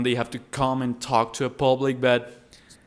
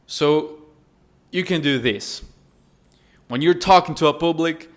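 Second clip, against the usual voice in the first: ordinary talk, nothing marked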